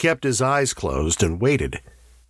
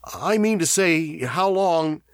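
higher-pitched, anxious follow-up; nervous; 9 semitones higher, slower than usual. A narrator's reading. Davies asking how long the sick man has, nervous. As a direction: fret your way to the question. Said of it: "said Davies nervously".

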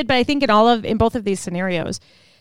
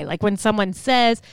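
usual speaking voice

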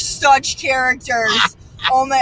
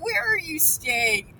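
grumpy border agent; 4 semitones higher, 7 dB quieter, much slower than usual. Retold or imitated. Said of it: "she was having a day".

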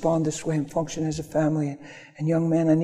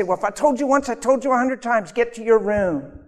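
high, stern parent scolding; exasperated mock scolding, voice pitched up; 6 semitones higher than usual. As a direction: scold the kid from the doorway. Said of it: "Don't just say".

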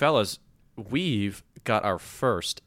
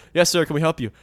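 higher, polite shop greeting; store employee; 6 semitones higher, 4 dB louder than usual. Acted. Acting the Apple store clerk, higher and polite. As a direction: greet a customer with put-on courtesy. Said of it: "I walk in the Apple store".

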